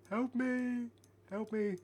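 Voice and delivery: high, pleading little bear voice; pleading teddy bear. Retold or imitated. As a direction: cry for help like a scorched toy bear. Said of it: "The bears are like".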